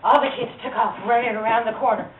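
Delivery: usual speaking voice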